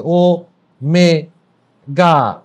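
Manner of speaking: ও, মে, গা each on its own beat with pauses; slow syllable count ও মে গা; split into syllables. Shown as a demonstration. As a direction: break ওমেগা into ও, মে, গা. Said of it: "ইংরেজিতে লিখলে কি আসে".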